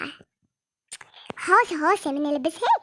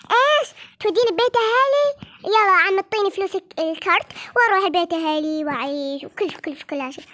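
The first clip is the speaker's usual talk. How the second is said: outraged quarrel; 3 semitones higher than usual. Acted. Spouse yells back about being sent home, outraged. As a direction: throw it back at the spouse, furious.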